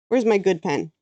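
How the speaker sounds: ordinary delivery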